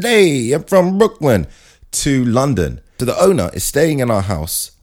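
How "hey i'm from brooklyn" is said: high, slow, drawn-out Brooklyn bit; Brooklyn accent. Acted. The dog announces where it is from, mock Brooklyn accent. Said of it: "fly his dog from Brooklyn".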